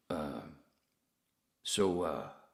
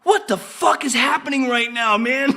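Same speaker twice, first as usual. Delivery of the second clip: much higher, loud and slow, cracking up; stunned, giggling shout; laughing disbelief; 14 dB louder than usual. Retold or imitated. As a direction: yell it like you can't believe your ears. Retelling shock at the radio.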